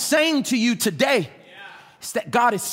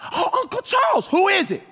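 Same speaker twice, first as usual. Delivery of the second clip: put-on higher, bright child voice greeting; lightly voiced happy little girl; excited child; 9 semitones higher than usual. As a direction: play the eager little daughter. Daughter greets uncle, voiced.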